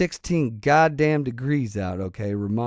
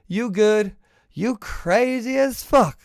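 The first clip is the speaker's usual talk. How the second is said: mock border patrol officer; 9 semitones higher than usual. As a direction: toss it off like a cop who thinks you're nuts.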